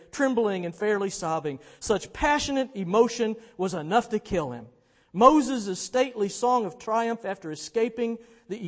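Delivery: loud, rolling, impassioned storytelling; dramatic reading. Acted. Preacher reading Muir's account of celebration, impassioned. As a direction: read it out with swelling excitement.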